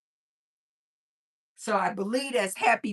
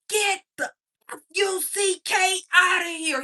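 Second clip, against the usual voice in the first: high, loud, spelled-out mock scream; imitated screaming; 9 semitones higher, 4 dB louder than usual. Gamer cursing out a child, imitated screaming. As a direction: imitate a man screaming, letter by letter. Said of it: "He is screaming".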